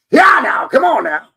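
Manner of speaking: high, loud yee-haw holler driving cattle; mock cowboy hollering, high and loud. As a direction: whoop it up like a cowhand herding. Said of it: "hooting and hollering".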